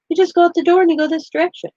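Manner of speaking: high, fast imitation of a child; kid giving directions. Son telling the officer the way home, imitated high and fast.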